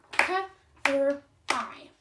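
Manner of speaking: "K-R-I" said as separate clapped sounds; a teacher segmenting "K-R-I" sound by sound for the class; sounding out cry. Shown as a demonstration. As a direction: tap out each sound of cry. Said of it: "what about the word cry".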